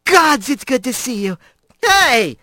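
high, gushing female voice; delighted Blaze. Acted. Blaze hugs Rat tight, delighted. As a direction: squeeze the joy into every word.

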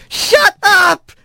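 high, drawn-out angry yell. A narrator's reading. Rat flails at the voice in blackness, high yell. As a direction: yell it high and desperate to silence him. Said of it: "he heard himself yelling".

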